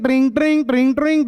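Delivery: bright ringing tring sounds; bicycle bell imitation. Shown as a demonstration. Teacher imitating a bicycle bell for the class. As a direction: ring it like a cycle bell. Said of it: "cycle की घंटी ट्रिंग".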